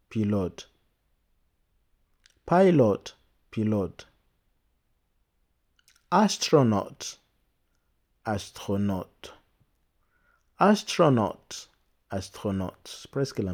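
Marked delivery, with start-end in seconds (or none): none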